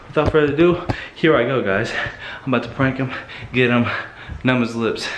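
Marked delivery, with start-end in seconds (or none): none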